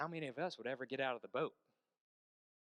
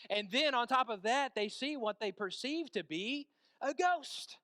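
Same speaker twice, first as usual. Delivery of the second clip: louder, higher sermon storytelling; impassioned preaching; 8 semitones higher, 7 dB louder than usual. Acted. Preacher telling congregation of terrified fishermen in the waves, impassioned. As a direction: preach it with mounting urgency.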